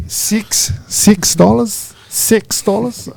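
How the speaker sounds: high, drawn-out Aussie "six dollars"; mock Aussie accent. Host joking on how an Aussie says six, slow high mock accent. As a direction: stretch it slowly in a put-on Aussie accent. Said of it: "like an Aussie trying to say".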